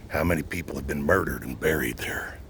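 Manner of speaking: hint at buried secrets under your breath; spooky whisper. Acted. Showing the swamp to viewers, mock-ominous whisper.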